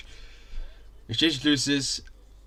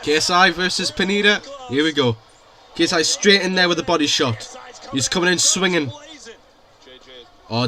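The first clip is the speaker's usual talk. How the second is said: excited commentary; 6 semitones higher, 10 dB louder than usual. Real, not acted. Streamer calling the fight live.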